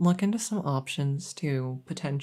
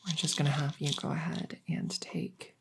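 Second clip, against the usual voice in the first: gentle ASMR whisper; whispered dosing instruction; 5 dB quieter than usual. Acted. Whispering the next dose.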